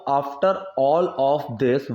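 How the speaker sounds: very slow, word by word for learners; slow model reading. Shown as a demonstration. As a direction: spell it out slowly like a teacher. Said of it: "teacher models English phrase". (बहुत धीमे, शब्द-दर-शब्द पढ़ाते हुए)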